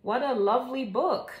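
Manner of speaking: ordinary delivery, nothing marked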